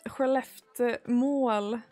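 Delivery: ordinary delivery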